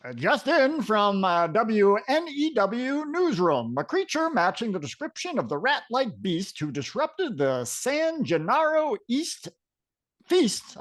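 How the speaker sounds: TV announcer voice. Acted. Newsroom announcer breaking news of the rat-like beast, announcer voice. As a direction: read it like a breaking-news anchor. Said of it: "do the announcer voice coming from the T V".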